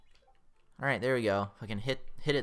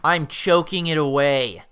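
loud, raised, drawn-out complaint; frustrated outburst; 5 semitones higher, 9 dB louder, slower than usual. Real, not acted. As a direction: wail about throwing the game away. Frustrated at losing lives.

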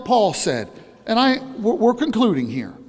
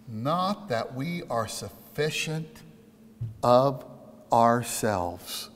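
slow hushed scripture reading; 9 semitones lower, 7 dB quieter, much slower than usual. Acted.

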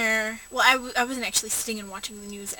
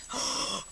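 retold gasp; 3 dB quieter than usual. Retelling spotting the carnival.

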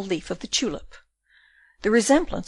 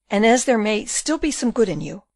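usual speaking voice

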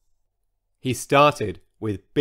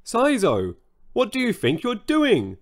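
crying out; 8 semitones higher than usual. A narrator's reading. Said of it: "cried the dinosaurs".

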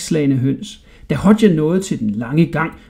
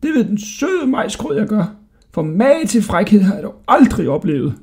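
high, outraged rant; indignant shouting; 6 semitones higher than usual. A narrator's reading. Jailer vowing to throw the boy out, high and outraged. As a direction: rage like a fed-up old jailer. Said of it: "råbte han".

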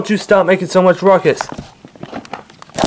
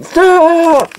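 long, high-pitched yell; drawn-out cry to stop; yelled protest; 12 semitones higher than usual. Acted. Yelling during a physical scuffle.

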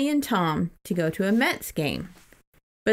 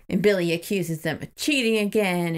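normal talking voice

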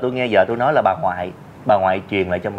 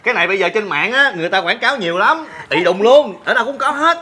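high, loud flustered rambling; nervous explaining; 12 semitones higher, 4 dB louder than usual. Acted. Man explains the flyer to the husband, flustered and high. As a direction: talk fast to save your skin.

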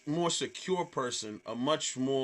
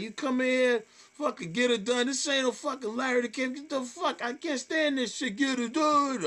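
high-pitched retold tirade; angry mocking rant; 10 semitones higher than usual. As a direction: go off on a heckler, ridiculing the catchphrase. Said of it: "started making fun of her".

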